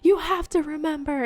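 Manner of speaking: retold yelling. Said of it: "yelling, like, passionately about it to her".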